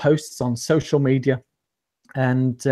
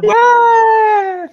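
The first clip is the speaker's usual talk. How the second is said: excited cheer; 8 dB louder than usual. Real, not acted. Guest cheering on being introduced.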